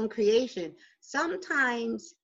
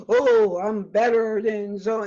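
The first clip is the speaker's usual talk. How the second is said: mock gasp, haughty; 6 dB louder, slower than usual. Imitating smug superior person.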